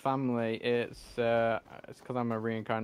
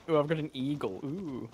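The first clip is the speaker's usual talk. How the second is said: higher, put-on boastful ooh; mock showing off; 4 semitones higher than usual. Streamer mocking the eagle owner, showy. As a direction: mockingly brag like a show-off. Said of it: "Very much showy".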